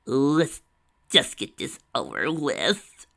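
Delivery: Tracy tells Lyra to get it over with, slow and dragging; drawn-out reluctance. A narrator's reading. Tracy grimly decides to start.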